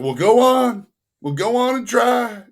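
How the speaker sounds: daring him with an amused push; high, loud go-on-then taunt; teasing dare. Retold or imitated.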